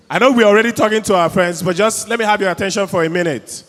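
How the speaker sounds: loud, projected host voice; appealing for attention. Real, not acted. Host asking a chatting audience for attention, loudly projected. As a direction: raise your voice over the chatter. Talking over a noisy crowd.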